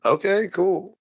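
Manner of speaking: high, breezy put-on voice; mock casual reply. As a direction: toss it off in a silly voice. Acted quote, curt reply.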